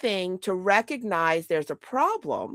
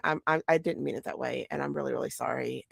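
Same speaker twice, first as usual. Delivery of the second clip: much softer, lower, quick contrite mumble; soft apology; 7 semitones lower, 8 dB quieter, faster than usual. Retold or imitated. The son texting his mother an apology, lower and much softer. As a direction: read the son's text softly and low. Son's apology text voiced.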